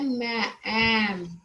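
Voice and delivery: मैं, एम stretched very slowly, sounding each part; drawn-out demo. Shown as a demonstration. Teacher showing pupils the vowel in मैम, very slow. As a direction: pull the sounds apart slowly. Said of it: "तो ये कैसे आ रहा है?".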